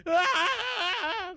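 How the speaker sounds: long, high, loud scream of fright; mock terrified scream. Streamer imitating Ryuji's scared reaction, mock scream. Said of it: "literally his reaction".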